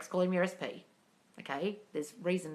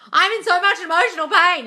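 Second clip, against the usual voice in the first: whiny, much higher and louder moan of self-pity; over-the-top wail about emotional pain; mock self-pity; 12 semitones higher, 16 dB louder than usual. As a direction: ham up a dramatic sufferer, high and loud. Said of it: "Your brain's like".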